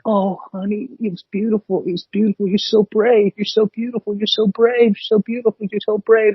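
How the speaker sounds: high, sugary mock gushing at the poet; gushing audience. Teasing impression of the crowd praising the poet, high and sugary.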